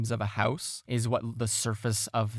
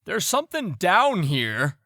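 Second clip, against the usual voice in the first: raised, slower call from a distance; yelling out; 7 semitones higher, 7 dB louder than usual. Acted. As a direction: yell it out slowly, higher and louder. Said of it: "yell out".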